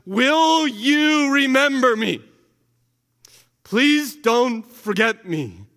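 slow, pleading, high and loud; desperate plea. Acted. Preacher voicing the dying thief begging Jesus, slow and pleading. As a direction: beg not to be forgotten.

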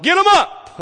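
high, loud heckle from the pew; imitated shout. Elderly woman interrupting the preacher's sermon, imitated shout. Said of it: "she'll shout".

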